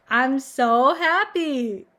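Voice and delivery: long drawn-out mock cheer; sarcastic happiness. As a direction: stretch it out in exaggerated fake delight.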